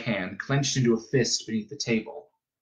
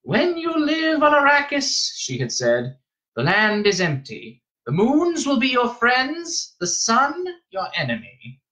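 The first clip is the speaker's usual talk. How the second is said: stern, high-pitched elder; old Reverend Mother, solemn; 12 semitones higher than usual. A narrator's reading. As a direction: intone a warning in a raised voice.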